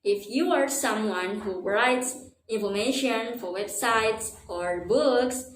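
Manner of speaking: usual speaking voice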